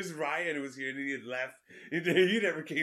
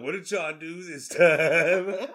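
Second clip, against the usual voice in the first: drawn out, amused, a little louder; slow laughing wonder; 8 dB louder, much slower than usual. Retold or imitated. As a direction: ask it slowly through a laugh. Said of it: "I honestly thought".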